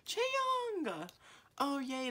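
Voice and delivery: very high, loud squeal of a name; delighted squeal. Real, not acted. Fan pulling her bias wrecker, delighted squeal. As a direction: shriek with delight.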